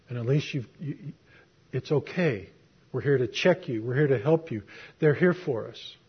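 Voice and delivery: soft, calm reassurance to a scared patient; reassuring fireman. Retold or imitated. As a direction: quietly soothe a frightened man.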